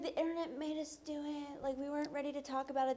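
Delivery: high mocking whiny excuse. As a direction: whine it like a fake excuse. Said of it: "they're gonna be like".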